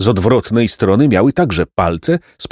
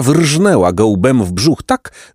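ordinary delivery

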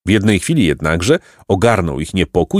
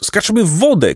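shouted cry; 11 semitones higher than usual. A narrator's reading. Jacek urges Placek into the water, high cry. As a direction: cry out on a raised pitch. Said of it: "krzyknął".

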